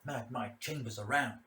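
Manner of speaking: ordinary delivery, nothing marked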